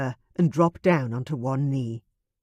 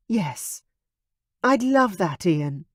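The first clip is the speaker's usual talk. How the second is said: touched; 3 semitones higher, slower than usual. Acted. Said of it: "eyes glistened with sudden tears".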